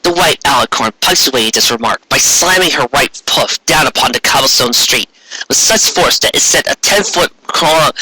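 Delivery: ordinary delivery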